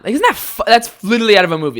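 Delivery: half-laughing outrage at the memory; incredulous. Real, not acted. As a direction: voice jumps up in disbelief. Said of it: "exclaims in disbelief".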